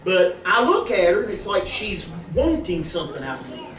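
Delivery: ordinary talk, nothing marked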